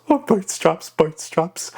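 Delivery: high, squawky pirate echo; Ragetti. Shown as a demonstration. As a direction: echo it high at the front of the mouth. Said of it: "when you combine them both together".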